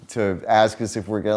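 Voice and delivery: normal talking voice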